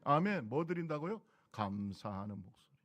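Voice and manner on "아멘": normal talking voice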